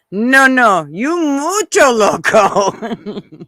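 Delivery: slow, laughing "mucho loco"; imitating neighbor, laughing. Retelling the neighbor's husband's joke, laughing through it. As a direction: quote the husband while cracking up. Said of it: "I just died laughing".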